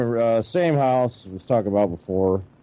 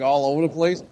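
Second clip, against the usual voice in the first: usual speaking voice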